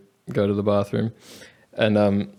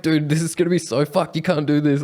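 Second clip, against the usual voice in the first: higher pitched and pressing, half laughing; alarmed protest; 6 semitones higher than usual. Retold or imitated. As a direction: plead with your mate not to do it. Friends protesting the bag plan.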